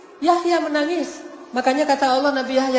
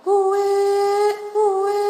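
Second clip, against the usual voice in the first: high imitation of a baby's wail; melodic newborn cry; 8 semitones higher than usual. Father imitating his newborn son's cry, high melodic wail. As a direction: mimic a baby crying on a held, sung note. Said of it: "pas lahir suaranya pakai irama".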